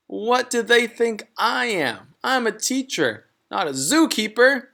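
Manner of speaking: a fussy teacher put out by the climb; indignant teacher voice. Acted.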